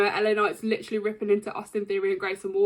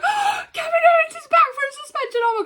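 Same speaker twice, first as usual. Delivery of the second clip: much higher, thrilled squeal of disbelief; ecstatic squeal; 4 dB louder than usual. Retold or imitated. Fan reliving her hero's return, ecstatic high squeal. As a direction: squeal it like your idol just came back. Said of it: "I was so ecstatic".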